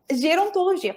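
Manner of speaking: acting out coming upon a new word; amazed discovery. Student finds the course in a careers book, amazed discovery. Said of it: "Foi algo assim".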